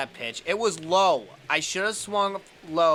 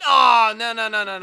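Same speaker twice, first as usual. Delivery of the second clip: dismayed no; 6 semitones higher, 10 dB louder than usual. Real, not acted. Watching a bad play.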